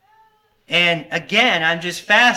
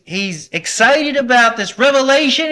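usual speaking voice

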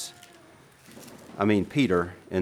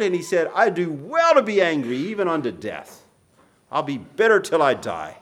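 raised, loud impassioned scripture reading; preaching at full force; 4 semitones higher, 4 dB louder than usual. Acted. Preacher voicing David's psalm to the congregation, loud and impassioned.

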